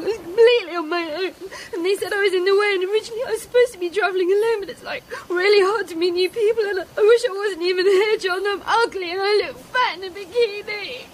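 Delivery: crying through a rambling complaint; tearful. Acted. Girl confiding her loneliness to Charles, crying. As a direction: pour it out while sobbing. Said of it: "deal with a girl crying".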